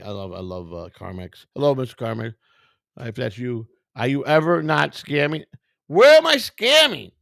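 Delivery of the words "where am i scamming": higher, louder and slow, offended; indignant outburst. Real, not acted. Streamer to accusing viewer, indignant outburst. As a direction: throw the accusation back at them.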